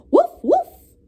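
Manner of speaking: very high, loud mock barks; barking like a dog. A narrator's reading. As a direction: bark to chase the birds. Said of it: "he cried".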